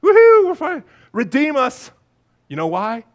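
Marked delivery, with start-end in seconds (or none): 0.0-0.8 high, loud carefree whoop; mock cheer. Crowd misread as celebrating, high mock cheer. 1.1-1.9 raised, acted cry for rescue; yelling plea. Crowd begging to be redeemed, raised yelled plea. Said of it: "they're yelling".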